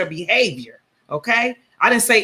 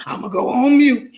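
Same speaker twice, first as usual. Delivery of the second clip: mocking impression; 5 semitones higher, 4 dB louder than usual. Host mimics the caller's whiny "I'll go on mute". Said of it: "'Cause that's all the fuck".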